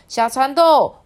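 high, loud call to a friend. Acted. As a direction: hail a little friend eagerly. Calls out to a classmate.

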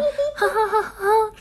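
very high cackling witch laugh; witch cackle. Shown as a demonstration. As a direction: cackle like an old hag. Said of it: "你可以看起来像巫婆的笑声".

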